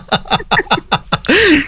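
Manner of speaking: high burst of laughter; bright laugh. Real, not acted. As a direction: laugh out at the joke. Host laughs at caller's joke. (کھل کر ہنسی)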